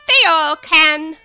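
loud, slow, haughty duchess; imperious plummy grande dame. Acted. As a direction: boom it out like a grand lady. Duchess voice held.

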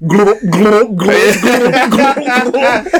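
high gulping glug sounds; gurgling fish man croak. Retold or imitated. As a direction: mime chugging with rising glugs. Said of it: "I'm like".

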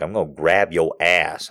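understated low mimicry; low matter-of-fact. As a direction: announce it low and quietly. Man calmly announces it, first take.

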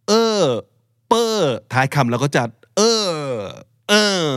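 exaggerated Thai-style "เออ" ending; boxing-cheer word endings. Shown as a demonstration. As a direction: overdo the final "เออ" with a long swooping tone. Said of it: "ประมาณนี้ เหมือนเชียร์มวย".

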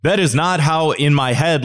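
normal talking voice

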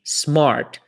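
smart with an American R before the T; English R demo. Shown as a demonstration. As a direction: say smart, curling the R.

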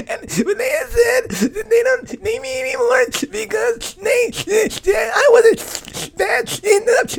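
loud, very high mock bawling; hammed-up wail, words dragged out between sobs; screaming and crying. Daughter explaining to mom what happened, screaming and crying. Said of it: "screaming on the phone, crying".

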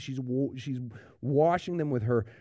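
normal talking voice

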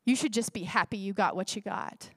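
lightly voiced tempter, pitched low; low, discouraging aside; enemy's lying voice. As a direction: imitate the enemy quietly wedging in a lie. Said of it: "continues to wedge those lies by saying".

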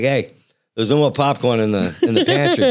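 put-on request in a Boston accent. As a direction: drop the Rs like a Bostonian. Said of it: "Say it in a Boston accent".

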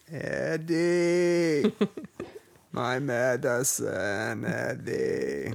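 bad impression of a gruff old actor; Walter Matthau impression. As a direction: ham up a rough old movie star voice, slowly. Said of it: "Horrible, um, Walter Matthau impression".

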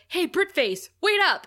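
loud high call, rising sharply at the end. Retold or imitated. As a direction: yell after them across the lot. Snyder calling after them.

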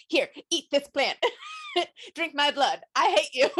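mock-petulant orders with laughter bubbling through; hammed-up grudging snippiness; pissy.

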